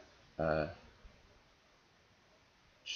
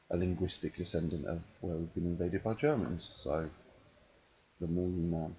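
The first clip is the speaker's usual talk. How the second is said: ordinary talk, nothing marked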